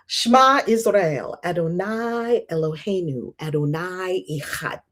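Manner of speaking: slow Hebrew recital of "Shema Israel"; Hebrew prayer. Retold or imitated. Teaching friend's Hebrew prayer, recited slowly. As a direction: chant "Adonai Eloheinu" slowly as in Hebrew. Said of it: "She taught it to me".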